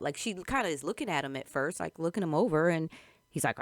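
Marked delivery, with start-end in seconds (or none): none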